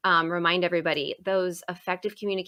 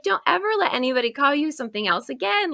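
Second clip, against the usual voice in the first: quick, high-pitched scolding; retold upset; 7 semitones higher, faster than usual. Teacher telling kid to guard his name, upset and quick. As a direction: re-enact an upset order in a rush. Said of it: "I was so upset".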